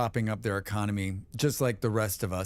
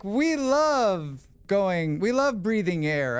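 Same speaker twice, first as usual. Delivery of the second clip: mock cheerful Canadian; 6 semitones higher, 3 dB louder, slower than usual. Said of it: "They're like".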